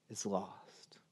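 breathed whisper for drama. Real, not acted. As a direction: drop to a hush on the last words. Dramatic pause in sermon.

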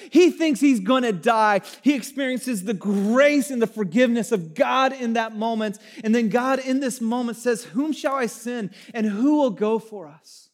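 loud, high preaching voice building the story; full-force preaching. Acted. Pastor preaching Isaiah's temple encounter to the congregation, loud and high. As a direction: preach it out to the whole room.

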